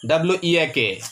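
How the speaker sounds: W E A K spelled letter by letter, each letter separate; spelling out. Shown as a demonstration. Teacher spelling W E A K for students, letter by letter. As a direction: spell out W E A K for the class. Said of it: "spells weak letter by letter".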